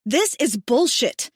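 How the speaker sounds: loud, slow furious bellow; angry bellow. Acted. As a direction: blow up at the men. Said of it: "she bellows".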